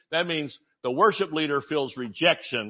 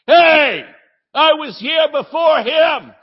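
much higher and louder indignant yell, slow; yelling New Yorker; 11 semitones higher, 11 dB louder, slower than usual. Retold or imitated. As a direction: bellow it like a pushy Long Islander in line. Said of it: "talking and yelling at each other".

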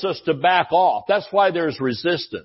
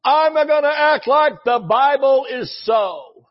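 higher, shouted preacher's declaration; Brother Hagin shout; 7 semitones higher than usual. Retold or imitated. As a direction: boom it out like an old-time preacher. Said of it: "Sound like Brother Hagin".

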